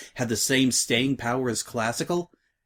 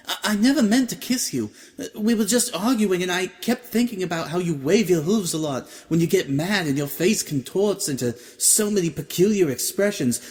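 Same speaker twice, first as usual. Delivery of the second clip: high, tumbling confession; confessing Octavia; 7 semitones higher than usual. A narrator's reading. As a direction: blurt it all out, mortified.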